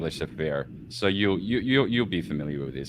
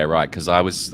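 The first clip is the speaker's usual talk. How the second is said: normal talking voice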